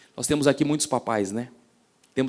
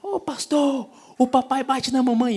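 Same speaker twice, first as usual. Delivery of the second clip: put-on high, small child's cry, much higher pitched; little girl's voice; 11 semitones higher, 3 dB louder than usual. Pastor imitating the little girl telling him in chapel, high child voice. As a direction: imitate a toddler blurting it out. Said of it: "se levantou e falou".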